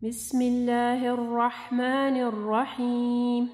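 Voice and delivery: slow, melodic tajweed chant; melodic Quran recitation. Acted. Teacher reciting the surah in full, melodic and slow.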